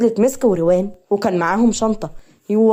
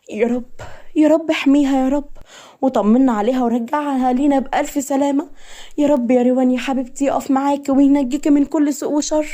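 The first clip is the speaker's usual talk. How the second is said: earnest, mildly raised-pitch supplication; pleading prayer; 4 semitones higher than usual. A narrator's reading. Friend praying for Rewan as usual, pleading. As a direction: plead with God on her behalf. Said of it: "بتصلي وبتدعي لروان كالعادة".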